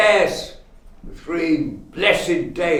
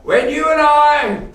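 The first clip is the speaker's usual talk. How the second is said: much higher and louder, bursting with greed; gleeful outburst; 11 semitones higher, 7 dB louder than usual. Acted. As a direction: blurt it out, thrilled by the scheme. Scrooge gloats over his profit scheme.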